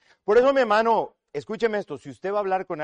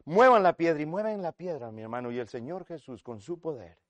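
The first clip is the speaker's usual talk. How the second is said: ordinary talk, nothing marked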